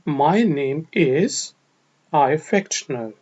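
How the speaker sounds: slow, careful teacher model read; drawn-out English model sentence; slow model phrase. Shown as a demonstration. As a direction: say it slowly for learners to copy. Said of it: "आप ये भी कह सकते हैं".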